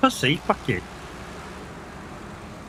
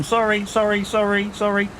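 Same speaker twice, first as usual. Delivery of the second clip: higher-pitched, lightly mimicked Canadian apologizing on repeat; mock Canadian accent; 6 semitones higher than usual. Streamer teasing a viewer for over-apologizing, mock Canadian accent. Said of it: "You sound fucking Canadian now 'cause you go".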